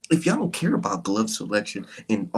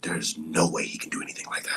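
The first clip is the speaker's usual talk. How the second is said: mock pearl-clutching; 6 semitones lower than usual. Mocking fans' reverent outrage.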